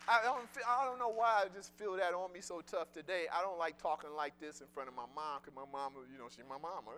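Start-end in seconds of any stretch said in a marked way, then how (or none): none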